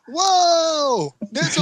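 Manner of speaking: high, loud hammed-up yelp; mock scream. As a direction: squeal it like a panicked passenger. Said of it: "when they're screaming".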